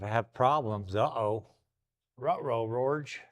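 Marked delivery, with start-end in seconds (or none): 2.2-3.2 slow cartoon dog voice; Scooby-Doo voice. Cartoon dog catchphrase after uh-oh.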